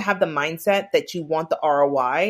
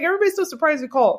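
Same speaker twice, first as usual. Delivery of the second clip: higher, quick, fond mimic of the friend; imitating the friend; 9 semitones higher, faster than usual. Mimicking his client to the cohost, higher and quick. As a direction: play her back lightly, a bit faster. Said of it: "She's like".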